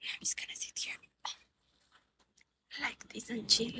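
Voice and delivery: murmured whisper, barely audible. Real, not acted. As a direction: whisper it like you're settling in to chill. Whispering repeat while sitting.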